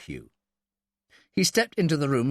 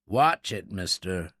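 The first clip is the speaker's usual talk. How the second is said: low growl; 6 semitones lower, slower than usual. A narrator's reading. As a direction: growl it as a threat. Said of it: "Miller growled".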